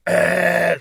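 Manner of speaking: loud, sustained blender-like "eh"; blender-like singing noise. Retold or imitated. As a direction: imitate a singer who sounds like a blender. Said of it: "his singing voice is that of a blender".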